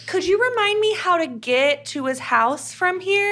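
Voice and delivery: fake sweet voice. Quoting woman's fake-sweet question.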